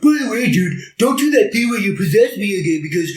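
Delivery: high, frantic-toned character quote; hammed-up high protest; acted-out pleading dude. Anthony's pleading line acted out.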